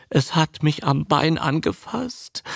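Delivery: high-pitched whimper; whimpering Darling. A narrator's reading. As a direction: whimper it like a frightened victim. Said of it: "wimmerte Darling".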